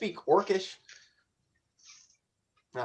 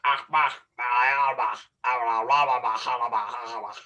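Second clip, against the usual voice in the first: mock Orcish gibberish; 3 semitones lower than usual. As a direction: bark nonsense syllables like orc speech. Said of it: "It's like".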